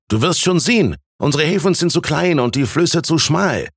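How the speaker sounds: high, lively explaining voice; eager young man. A narrator's reading. Student telling Thies about new trade, high eager voice.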